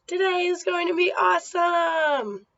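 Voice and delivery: high, loud cheer to end the motto; excited shout. Shown as a demonstration. Teacher fires up the class with a high loud shout. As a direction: shout it out like a pep rally. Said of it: "so repeat after me".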